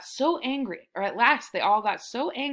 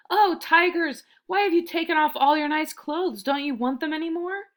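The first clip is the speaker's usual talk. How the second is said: high, louder call from afar; 7 semitones higher, 4 dB louder than usual. A narrator's reading. Rajani calls out to the tigers far below, loud. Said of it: "called out".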